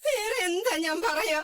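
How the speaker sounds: slow, crying cry-out; tearful outburst. Acted. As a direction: wail it slowly through sobs. Aunt crying in the quarrel. (കരഞ്ഞ് പതുക്കെ നിലവിളിച്ച്)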